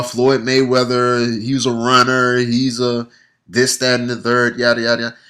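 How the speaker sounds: droned list in a level monotone; dismissive recital. Retold or imitated. Fight fan repeating critics' tired complaints, dismissive drone.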